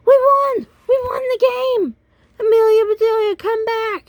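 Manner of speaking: very high, loud victory yell; thrilled winning shout; excited yell. Acted. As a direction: celebrate at the top of your voice. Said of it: "Then Tom yelled".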